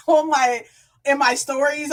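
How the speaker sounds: usual speaking voice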